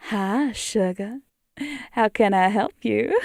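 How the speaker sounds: slow southern drawl; southern accent. Acted. Playing a Southern cowgirl, slow Southern accent. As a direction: stretch out a bad Southern greeting. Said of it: "My southern accent is so shit".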